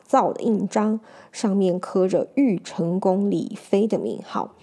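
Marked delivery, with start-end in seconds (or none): none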